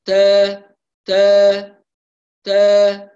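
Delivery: تا with a loud burst of breath; تاء مبالغ في همسها; overaspirated ta. Shown as a demonstration. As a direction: blast air on each تا, the mistake. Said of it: "هذا خطأ".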